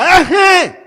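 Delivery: high, shouted interjection; loud exclamation. Retold or imitated. Speaker reacting to her not shouting at boss, loud exclamation.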